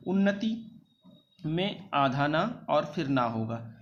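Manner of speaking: ordinary talk, nothing marked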